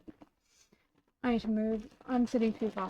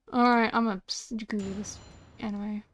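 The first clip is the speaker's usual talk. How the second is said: usual speaking voice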